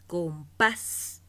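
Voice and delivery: Compás loud, with the weight on pás; final syllable stressed. Shown as a demonstration. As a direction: punch the last syllable of Compás. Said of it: "Fíjate los siguientes ejemplos". (Compás con fuerza en la última sílaba)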